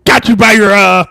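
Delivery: loud, drawn-out gloating catch; mock captor. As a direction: play the villain who has caught someone. Acts out a gotcha interrogator.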